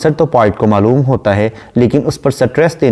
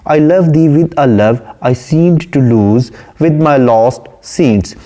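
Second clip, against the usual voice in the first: slow, measured poem reading; unhurried English verse reading; poem recitation; 3 semitones higher, much slower than usual. Shown as a demonstration. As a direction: recite the line slowly, savouring it. Reads poem line slowly.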